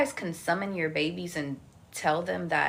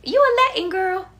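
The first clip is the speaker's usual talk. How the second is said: high mock voice of doubting viewers; 6 dB louder than usual. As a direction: mimic the skeptics who doubt her roots, much higher. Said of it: "y'all be like".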